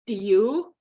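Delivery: slightly unsteady, uncertain question; a bit quavery. A narrator's reading. Caring what her roommate thinks, a bit quavery. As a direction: ask it with a small quaver. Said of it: "My question came out a bit quavery".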